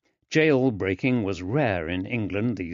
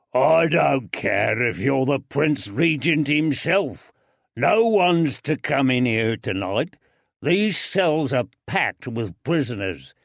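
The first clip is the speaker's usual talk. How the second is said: gruff jailer; 4 semitones higher, 4 dB louder than usual. Acted. Jailer threatening at gate.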